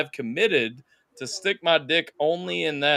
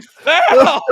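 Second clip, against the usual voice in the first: loud high foul; calling foul on the doctor, loud and high; mock outraged call; 10 semitones higher, 14 dB louder than usual. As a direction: yell it like a referee. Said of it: "I'm like".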